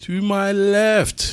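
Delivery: high, very slow announcement; drawn-out ringside-style cue; show intro announcer. Acted. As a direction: call out the next guest, stretched long. Announces the next co-host.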